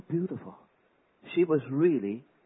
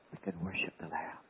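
soft reverent whisper; 7 semitones lower, 9 dB quieter than usual. Real, not acted. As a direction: draw them in with a hush. Sermon whisper.

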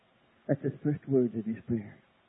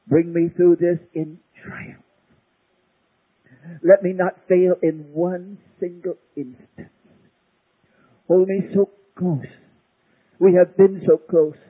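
louder, slow and fervent; fervent prayer; 4 semitones higher, 7 dB louder than usual. Acted. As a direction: pray it out with conviction.

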